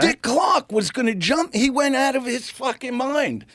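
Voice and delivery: high, loud, laughing excitement; excited. Real, not acted. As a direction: pitch up with delighted disbelief. Excited telling of the chaos.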